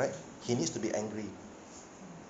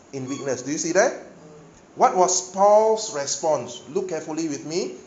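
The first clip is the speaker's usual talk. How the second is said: full-force preaching; 4 semitones higher, 7 dB louder than usual. Acted. Preacher drives Paul's verses home to congregation, loud preaching.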